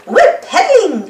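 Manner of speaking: high, loud yell; shout. Acted. Peppa shouts to her friends on the lake, high and loud. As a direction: holler it out happily. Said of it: "shouts Peppa".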